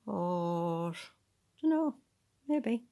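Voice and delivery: ordinary delivery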